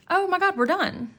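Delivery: a sudden high burst of delight; excited. Real, not acted. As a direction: cry out, thrilled it's finished. Finishing the last day, delighted.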